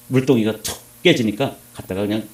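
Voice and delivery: ordinary talk, nothing marked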